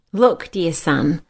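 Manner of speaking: ordinary talk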